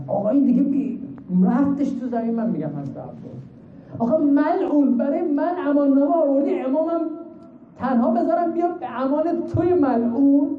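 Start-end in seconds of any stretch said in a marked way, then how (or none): 4.0-10.5 high, ringing cry of outrage at the messenger; indignant rebuke. Acted. Abbas rejecting the enemy's safe-conduct letter, outraged high cry. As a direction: fling the refusal back, voice raised high.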